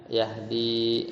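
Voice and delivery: "Yahdi" with a drawn-out final i; long final vowel. Shown as a demonstration. Teacher repeats the restored ya, long final i. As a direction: hold the end of "Yahdi" long. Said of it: "seperti itu".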